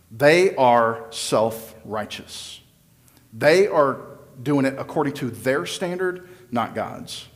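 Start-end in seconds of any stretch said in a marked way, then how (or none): none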